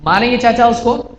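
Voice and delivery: ordinary delivery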